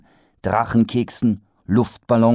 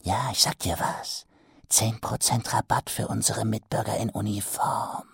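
whispered; 7 dB quieter than usual. Acted. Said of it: "flüsterte Ruin".

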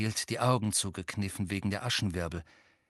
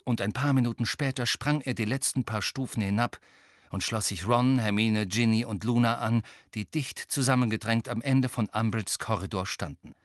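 ordinary delivery, nothing marked

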